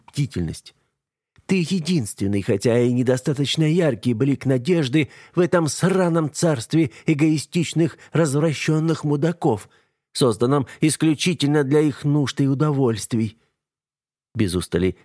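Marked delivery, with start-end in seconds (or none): 1.5-13.4 bitter woman. A narrator's reading. Eva's bitter speech.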